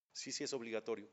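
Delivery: ordinary talk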